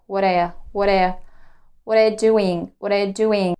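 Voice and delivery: fast, "what are you" blurred into /wʌt a/; connected speech. Shown as a demonstration. Teacher drills linked speech, fast and blurred. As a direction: run the words together quickly. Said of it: "I connect those words and I say".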